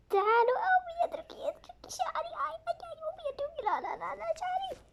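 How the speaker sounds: high breathy pleading; whispered whine. Acted. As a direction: whimper to let go of the hair. Child whining hair pulled again. (أنين هامس)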